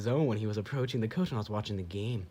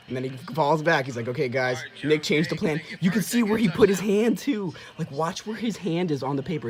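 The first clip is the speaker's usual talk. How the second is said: much higher and louder, building hype; rising excitement; 6 semitones higher, 8 dB louder than usual. Real, not acted. Fan breaking down the replay to viewers, loud and excited. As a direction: get more and more worked up.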